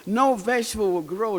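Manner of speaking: usual speaking voice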